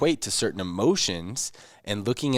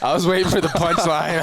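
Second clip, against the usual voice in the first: laughing; 9 semitones higher, 7 dB louder than usual. Real, not acted. Recalling a funny moment.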